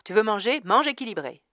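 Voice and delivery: loud, high commanding slogan; authoritative. A narrator's reading. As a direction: bark it like an order. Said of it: "La voix du panneau d'après était plus autoritaire".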